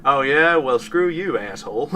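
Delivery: put-on half-hearted American Western accent; terrible cowboy accent. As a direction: toss off a bad cowboy accent at a rude stranger. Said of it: "That was a terrible accent".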